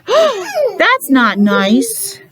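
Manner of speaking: a gasp, then a high, slow "that's not nice"; mock-shocked for the children. Reader gasping at the spanking for the kids. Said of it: "reader reacts to the spanking".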